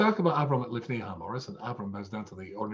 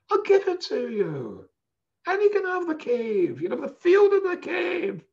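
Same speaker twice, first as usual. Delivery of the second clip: sweet nice guy; 7 dB louder than usual. Retold or imitated. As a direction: pour on the sugar, sound far too kind. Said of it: "and he sounds so nice".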